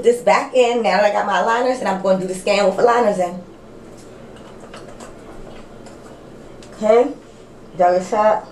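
lips pulled back from teeth; talking with cheek retractor in. Real, not acted. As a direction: stiff stretched mouth speech. Seen 1: Lips stretched by retractor over aligners while talking, holding scanner.

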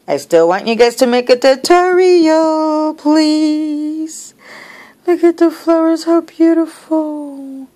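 excited, high-pitched admiration; gushing plea. Real, not acted. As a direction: beg and gush in a high voice. Pleading, gushing over gift.

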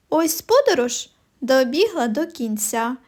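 ordinary talk, nothing marked

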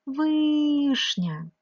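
"вишня" with a very long, high, loud "и"; stressed vowel. Shown as a demonstration. Teacher showing the vowel now stressed, drawing it out. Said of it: "став наголошеним".

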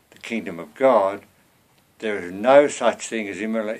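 normal talking voice

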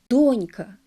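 донька stressed as дОнька; first-syllable stress. Shown as a demonstration. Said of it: "наголос на першому складі". (наголос на першому складі: дОнька)

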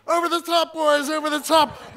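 high shouted charge order; officer's shout. Retold or imitated. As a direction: yell the troops over the top.

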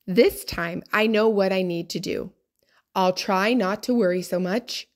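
ordinary delivery, nothing marked

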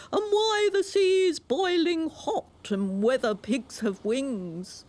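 high, slow storybook walrus oration; grand walrus. A narrator's reading. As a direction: announce it like a pompous walrus. Walrus speech.

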